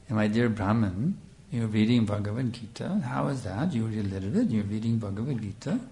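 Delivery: soft, tender and kind, lightly voiced; sweet gentle voice. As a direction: ask him softly and lovingly, as the Lord would. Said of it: "we can try to make a crude approximation".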